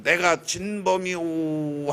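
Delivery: slow, solemn admission; slow confession quote. Retold or imitated. A man confessing before the crowd, slow and solemn. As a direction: drag out the confession slowly. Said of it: "하고 나갔다는 거예요".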